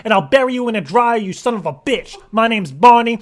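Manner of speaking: loud, high, mock-furious tirade; angry outburst. Caller losing his calm at corporate people, angry. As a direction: blow up into a hammered, indignant rant.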